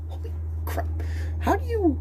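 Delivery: breathy gasp then squeaky high question; stunned, whispered then squeaked; amazed gasp. Retold or imitated. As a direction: gasp in awe at her speed. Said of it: "I'm like".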